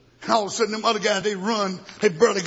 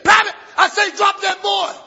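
high, loud command; colonel's order; 12 semitones higher, 7 dB louder than usual. Retold or imitated. Colonel yelling at the private to drop the boy.

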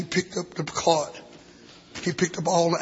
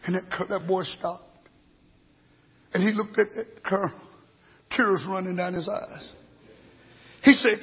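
usual speaking voice

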